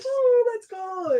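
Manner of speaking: high, drawn-out "let's go" squeal; hyped-up cheer. Retold or imitated. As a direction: imitate a hyped fan cheering, stretched way up high. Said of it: "she was getting hyped".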